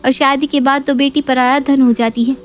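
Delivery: a hint of a spiteful daughter; Neha's scornful voice. Neha voiced in a story.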